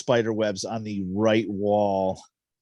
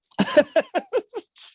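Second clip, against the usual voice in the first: sharp high squeal of laughter; high giggle at the spider gag; 4 dB louder than usual. Real, not acted.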